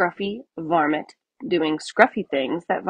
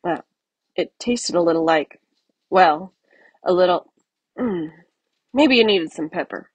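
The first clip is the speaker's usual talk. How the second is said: ordinary delivery, nothing marked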